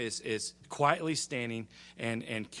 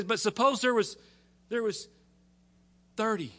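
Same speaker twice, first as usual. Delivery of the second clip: high, halting haggle; Abraham pestering; 8 semitones higher, 5 dB louder than usual. Retold or imitated. Abraham haggling with the Lord, high and pestering. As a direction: push the offer, pausing before the number. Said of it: "irritating little friends".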